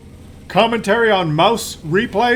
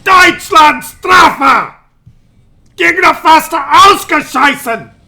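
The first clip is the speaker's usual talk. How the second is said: loud, higher, slow German barking; mock German shouting; 7 semitones higher, 6 dB louder, slower than usual. Mock German crew shouting at the enemy, loud. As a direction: bellow it like a German tank crew.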